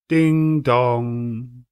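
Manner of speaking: ding dong rung out loud and long like a clock bell; chiming bell. A narrator's reading. Narrator ringing out the clock chime, loud and sustained. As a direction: sound it like the tolling bell.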